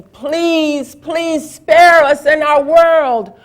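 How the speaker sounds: high, loud pleading cry; desperate begging. A narrator's reading. Terrified people beg Shakra to spare their world, high loud pleading. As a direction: beg for your lives. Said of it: "the people were on the ground begging".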